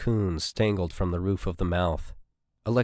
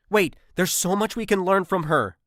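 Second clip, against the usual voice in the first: pleading; 11 semitones higher, 3 dB louder than usual. A narrator's reading. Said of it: "pleaded Miranda".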